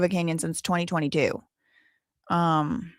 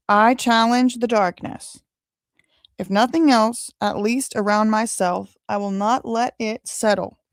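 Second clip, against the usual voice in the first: slow, earnest recitation, a little higher; reading the shirt aloud; 4 semitones higher than usual. Shown as a demonstration. Reading the shirt's saying to viewers, slow and solemn. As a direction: read the saying like a solemn creed. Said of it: "so it says".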